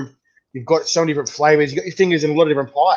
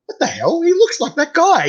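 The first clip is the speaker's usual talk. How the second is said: surprised exclamation; 13 semitones higher, 4 dB louder than usual. Retold or imitated. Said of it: "I'm going".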